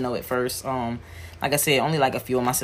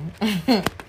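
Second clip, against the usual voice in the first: short, higher-pitched chuckle; small laugh; 8 semitones higher than usual. Real, not acted. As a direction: chuckle briefly at your own story. Amused at their long road trip.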